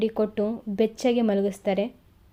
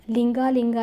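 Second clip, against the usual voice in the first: ordinary delivery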